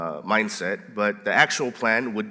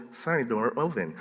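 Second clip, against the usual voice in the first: Telus alarm lady; 8 dB quieter than usual. Retold or imitated. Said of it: "press that button to go".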